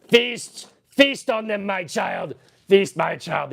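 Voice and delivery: hammy monster parent. Pretend. Streamer urges Furby child to feast, mock-villain command. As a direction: grandly urge the creature to feed. Urging Furby to eat victims.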